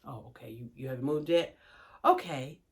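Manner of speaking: low, soft mock-calm; softly, over-patiently noticing; restrained patience. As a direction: hold it down to a quiet murmur. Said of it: "Then I say again".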